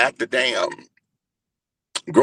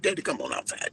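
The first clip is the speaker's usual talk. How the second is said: a much softer, hushed plea to come outside; hushed urging; 4 semitones higher, 10 dB quieter than usual. Retold or imitated. Son hushing his crying father toward the door, softly. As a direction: keep it quiet so nobody hears.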